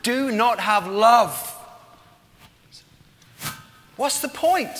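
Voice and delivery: slow, swelling loud and high, pause before the question; emphatic preached challenge. Retold or imitated. Preacher quoting Paul on love, loud impassioned challenge. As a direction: ring it out slowly, then throw the question high.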